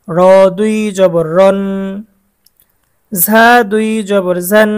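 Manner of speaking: steady chant-like drill with held endings; repeat-after-me drill. Shown as a demonstration. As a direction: sound out each letter for pupils to follow. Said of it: "সাথে সাথে পড়তে হবে".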